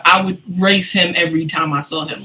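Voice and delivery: usual speaking voice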